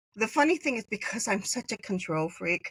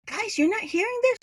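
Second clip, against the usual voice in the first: surprised; 12 semitones higher than usual. Retold or imitated. Amazed others hear nothing.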